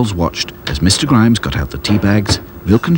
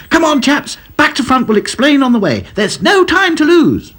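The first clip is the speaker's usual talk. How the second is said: eager call to action. A narrator's reading. Dotty urges the dolls.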